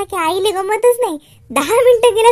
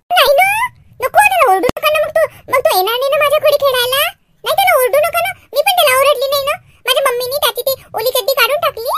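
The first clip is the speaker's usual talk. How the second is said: put-on high, insistent little-girl plea; pleading child; 7 semitones higher, much faster than usual. Girl begs mother not to scold Shyam, pleading. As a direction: beg for a friend in a high voice.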